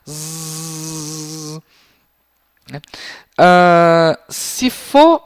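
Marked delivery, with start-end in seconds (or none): 0.1-1.6 soft bee buzz. Shown as a demonstration. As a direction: make the bee's buzz. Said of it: "reproduzem o som".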